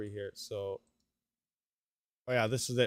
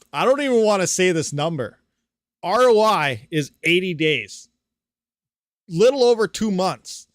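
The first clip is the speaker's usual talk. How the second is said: louder, higher burst of disbelief; amazed announcement; 5 semitones higher, 10 dB louder than usual. Real, not acted. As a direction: blurt out the shocking number. Reveals shocking ROI figure.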